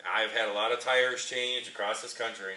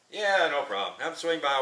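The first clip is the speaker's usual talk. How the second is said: upbeat agreement; 6 semitones higher, 6 dB louder than usual. Retold or imitated. Tire guy agreeing.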